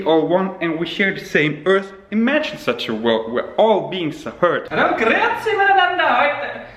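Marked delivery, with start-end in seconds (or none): 4.7-6.6 high-pitched, hammed-up Bavarian accent; mock Bavarian accent, high and showy; pretentious Bavarian accent. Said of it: "That is my pretentious Bavarian accent".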